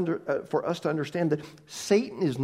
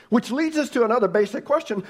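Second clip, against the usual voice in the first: normal talking voice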